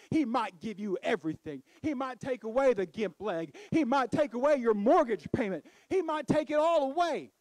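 building to a loud, high preaching climax; preaching at full force. Real, not acted. Preacher to the congregation, building to a shouting climax.

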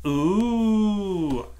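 high, drawn-out ooh of wonder; awed kids' chorus; children's gasp. A narrator's reading. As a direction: gasp it out long, high and loud. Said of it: "gasped the children".

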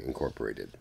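usual speaking voice

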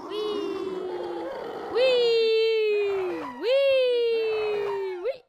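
long, high, gliding whee; playful "whee". Pretend. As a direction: squeal a drawn-out whee as if flying. Flying in game mode.

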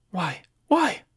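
anguished why. Acted. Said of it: "I murmured to myself".

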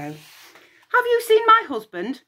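high, slow, imperious goddess demand; high slow haughty demand. Acted. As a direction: demand it like an impatient queen. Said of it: "she demanded".

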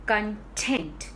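content as con-TENT; stress on second syllable. Shown as a demonstration. Teacher defining happy or satisfied, content stressed on TENT. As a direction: put the weight on TENT in content. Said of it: "stressing on the second syllable".

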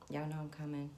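usual speaking voice

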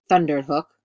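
loud, booming narrator's retake; thundering. A narrator's reading. Retake performs the word thundered.

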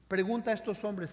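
usual speaking voice

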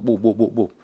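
loud mock gunshots with the mouth; gunshot imitation. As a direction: fire off each boop like a shot.

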